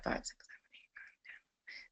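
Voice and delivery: whispered aside while working out the time; whispered counting. Real, not acted. Counting time zones under breath.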